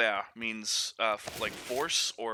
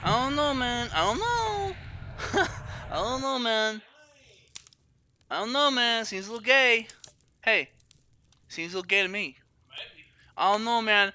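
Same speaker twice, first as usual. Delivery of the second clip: high mock dude voice; 10 semitones higher than usual. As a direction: sneer it like a doubtful bro. Mock voice commenting on death.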